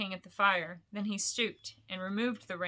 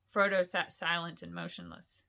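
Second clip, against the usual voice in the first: ordinary delivery, nothing marked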